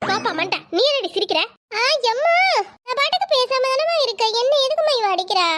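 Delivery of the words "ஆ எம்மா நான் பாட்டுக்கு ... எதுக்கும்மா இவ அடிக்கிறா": whiny complaint to mother. Acted. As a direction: wail that she's being hit again. Sister whines she is being hit.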